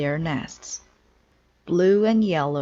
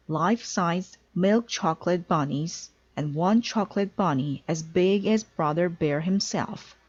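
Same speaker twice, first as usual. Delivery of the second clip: plain reading voice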